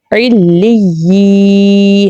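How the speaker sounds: علی with a firm doubled y and long ee; correct doubled ya. Shown as a demonstration. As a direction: stretch the clean y-glide ending of علی. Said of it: "ہمیں کیا پڑھنا ہے؟".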